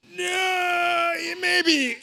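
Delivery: loud, high, half-hearted mock no; mock wavering no. Imitating the congregation's faces, a loud, high mock waver. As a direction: play someone who can't refuse. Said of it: "your faces are just like".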